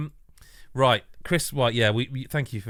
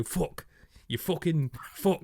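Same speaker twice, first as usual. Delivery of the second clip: higher, slower mock Northern swearing; Northern accent; 8 semitones higher, 4 dB quieter, slower than usual. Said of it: "The only way I know to do Northern".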